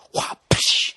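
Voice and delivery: whooshing cane swing and crack; wah, then a sharp rush of air; imitated cane strike. Comic imitating the caning, mouth-made swish and crack. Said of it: "the fucking guy goes".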